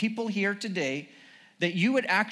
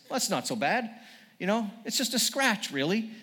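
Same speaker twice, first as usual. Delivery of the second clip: light put-on English accent; understated English-accented reassurance; 3 semitones higher than usual. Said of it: "an English accent".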